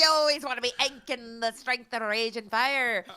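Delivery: slow, hammed-up British caster line; mock British accent. Doing a British caster on request, slow hammed-up accent. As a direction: lay on a fake British accent, slowly. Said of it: "One more time?".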